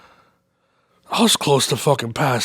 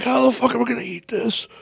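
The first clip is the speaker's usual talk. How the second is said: high disbelieving question; incredulous; 10 semitones higher than usual. Retold or imitated. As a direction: ask as if beaten by the food. Retold disbelief.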